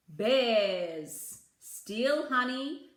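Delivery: ordinary delivery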